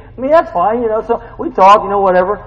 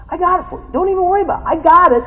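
rising, louder eager insistence; pushing hard to carry it for you; insistent offer; 14 semitones higher, 6 dB louder, faster than usual. Retold or imitated. Friend insisting on carrying the burden, eager and loud. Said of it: "He says".

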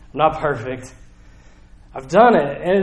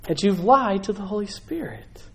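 crushed; 5 semitones higher than usual. Retold or imitated. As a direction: ask it as if betrayed by a friend. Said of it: "I think Peter's crushed".

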